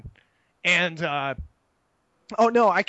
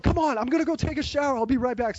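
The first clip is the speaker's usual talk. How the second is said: hurried chatter; 6 semitones higher, faster than usual. Retold or imitated. Rushing off to shower.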